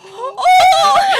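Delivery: very high, loud, laughing cry; shocked shout. Retold or imitated. Mother hearing the news, laughing shocked yelp. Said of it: "and then I went".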